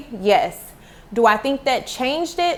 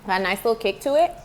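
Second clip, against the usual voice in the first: mouth full; faster than usual. Real, not acted. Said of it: "I was chewing too much".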